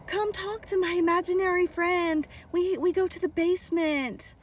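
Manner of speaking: put-on high child-like coaxing; insistent little girl. Birthday girl urging princess down to basement, high coaxing voice. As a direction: pitch it up like a pleading kid.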